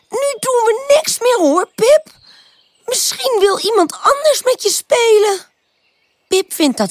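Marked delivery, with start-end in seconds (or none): 0.1-5.5 high, piping little flower voice; squeaky. A narrator's reading. Worn-out tulips turning Pip down, squeaky. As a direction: refuse in a tiny squeak. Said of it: "piepen de tulpjes".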